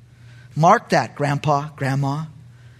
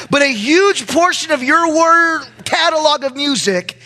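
preaching at full force; 11 semitones higher, 5 dB louder than usual. Real, not acted. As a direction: preach it out to the congregation.

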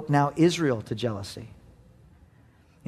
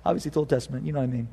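ordinary talk

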